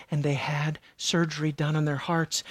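ordinary delivery

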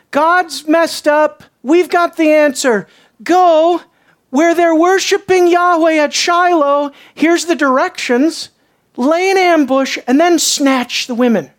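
hammed-up, forceful announcement; mock proclamation. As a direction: hand down the plan with exaggerated confidence. Mockingly voicing the elders' solution.

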